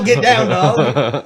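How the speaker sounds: slow, drawn-out, laughing dog command; mock the phrase slowly through laughter. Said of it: "Going back to this whole".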